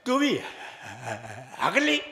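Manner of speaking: halting, laughing groom voice; stammering groom. Acted. Stammering groom answering his stammering bride, halting. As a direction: break it up in halting bits. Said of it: "दूल्हा हकला".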